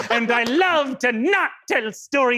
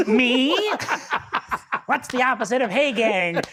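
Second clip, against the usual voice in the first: ordinary talk, nothing marked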